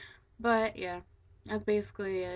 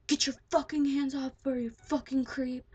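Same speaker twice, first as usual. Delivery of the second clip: loud, higher furious shout at a creep; angry yell; 5 semitones higher, 5 dB louder than usual. Retold or imitated. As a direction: tell him off, loud and sharp. Friend yells at groping creep.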